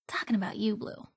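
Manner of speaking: a teasing unvoiced whisper. Companion teasing Blue in a hushed whisper. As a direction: lean in and breathe it conspiratorially.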